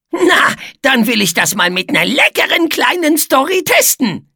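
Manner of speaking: high, mischievous character voice; gleeful teasing. A narrator's reading. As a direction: propose it with a sly giggly lilt. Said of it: "kicherte Etze".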